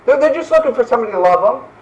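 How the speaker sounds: ordinary delivery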